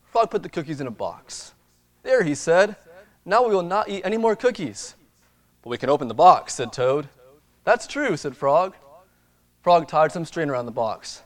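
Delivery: lively storybook reading; reading to children. Acted. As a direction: read it like a bedtime picture book. Reads storybook to congregation.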